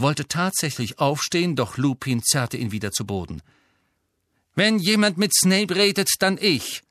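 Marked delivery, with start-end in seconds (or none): 4.5-6.8 high, loud, resolute; firm and decided. A narrator's reading. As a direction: settle it with authority. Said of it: "meinte er entschieden".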